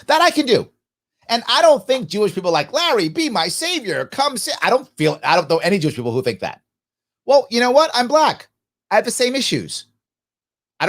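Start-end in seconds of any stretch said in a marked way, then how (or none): 2.7-4.6 lightly hammed-up pleading, higher pitch; half-mocking cry for a savior; mock plea. Voicing imagined pleading people.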